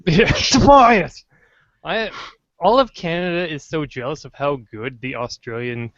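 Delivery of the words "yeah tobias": drawn-out high, loud cheer of Tobias; excited cheer. Real, not acted. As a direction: yell Tobias slowly with delight. Cheering a Simpsons bit.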